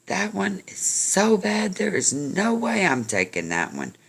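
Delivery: high, emphatic refusal; acted quarrel. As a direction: play a fed-up man arguing, voice jumping up. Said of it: "one of 'em perks up and says".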